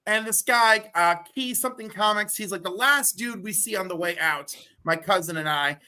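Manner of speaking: usual speaking voice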